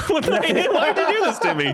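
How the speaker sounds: high-pitched laughing outrage; laughing protest. Real, not acted. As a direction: cry out through laughter. Bad roll, laughing protest.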